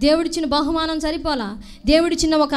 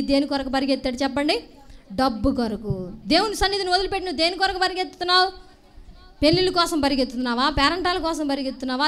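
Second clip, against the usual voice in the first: usual speaking voice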